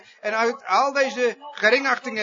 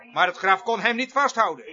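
usual speaking voice

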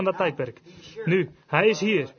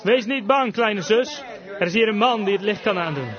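raised, emphatic retold call; 2 semitones higher, 3 dB louder than usual. Preacher echoing the brother's words to the frightened sister, calling out. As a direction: proclaim the reassurance as you call it out. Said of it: "haar broertje riep haar toe".

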